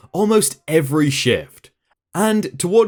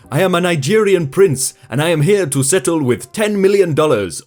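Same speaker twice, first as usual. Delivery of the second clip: badly faked Nigerian prince accent; mock Nigerian accent, pitching a scam; awful Nigerian accent. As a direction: put on a hammy Nigerian accent for a scam call. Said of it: "that was awful, that accent".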